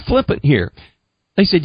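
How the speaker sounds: ordinary talk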